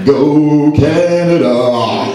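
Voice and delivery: slow, drawn-out goofy cheer; "Go Canada" said very slowly, with a laugh; mock cheer. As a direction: stretch "Go Canada" out slowly like a clueless fan. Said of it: "It's actually "Oh Canada".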